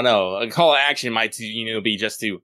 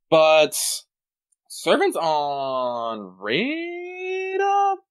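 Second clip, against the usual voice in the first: extremely slow, stretched words sliding high; drawn-out pondering; 4 semitones higher, much slower than usual. Real, not acted. Pondering a listener's question, hugely drawn-out. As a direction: stretch each word while stalling for thought.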